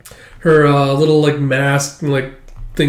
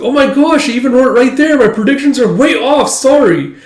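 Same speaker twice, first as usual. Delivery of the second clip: put-on much higher, louder incredulous kid; amazed girl; 11 semitones higher, 7 dB louder than usual. [name] reading the fortune teller's sign aloud, high amazed. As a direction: marvel at the sign like a stunned schoolgirl.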